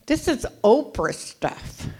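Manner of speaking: slow, sarcastic aside; mock Californian. Imitating how Texans see her, mocking and slow. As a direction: drawl it out mockingly.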